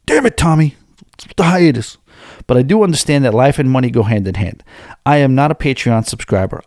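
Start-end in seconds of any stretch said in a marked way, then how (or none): 0.1-2.0 higher, hammed-up exasperation; mock angry outburst. Host imagining a pissed listener kicking things, mock angry. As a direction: play at being furious with the host. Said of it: "kicking things and punching walls".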